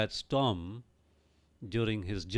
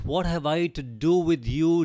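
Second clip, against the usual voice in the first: raised, higher demon cry from the man; loud voice; 4 semitones higher, 5 dB louder than usual. Acted. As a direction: call it out loudly as the demons. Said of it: "with a loud voice".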